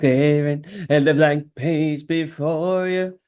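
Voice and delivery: slow, drawn-out words; hammed-up slow delivery; mock dramatic reply. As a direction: stretch it out teasingly, in no hurry. Hams up answer to teasing.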